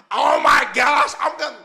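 high loud slow shout; hammed-up panicked scream; mock shriek. Imitating a woman shrieking when water hits her hair.

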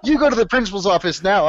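high, loud mock order; mock stern teacher. Teacher sending the student out, high mock stern. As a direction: bark it as a hammed-up strict teacher.

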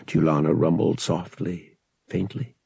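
ordinary delivery, nothing marked

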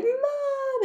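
high shout for mom; calling Mom. Retold or imitated. Upset child shouts for her mom from the kitchen door. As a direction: yell for mom from the doorway.

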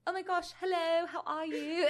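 high-pitched, hammed-up British accent; bad British accent. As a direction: gush in a badly faked British accent. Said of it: "mirror him with a bad British accent".

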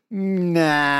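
unconvinced nah. Retold or imitated. Australia rejecting David Warner's apology, unconvinced. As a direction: stretch "Nah" out in open disbelief. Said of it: "not buying it".

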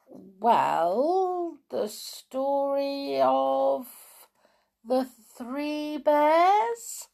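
put-on drawn-out, puzzled child voice; slowly, as a surprised little girl; slow wondering girl. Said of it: "said Franny".

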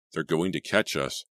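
usual speaking voice

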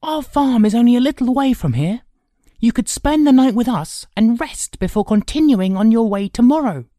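put-on high, loud boyish voice; hospitable boy. Boy offering the old man shelter, hospitable. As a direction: press the invitation, high and eager.

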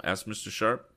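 ordinary delivery, nothing marked

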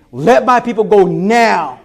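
slow, loud, high commanding Moses; bold command. Retold or imitated. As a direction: declare it boldly and slowly. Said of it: "Stand with boldness and say".

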